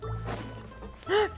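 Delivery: sharp gasp of surprise; delighted gasp. Real, not acted. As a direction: gasp on spotting something cute. Streamer spots something cute in game.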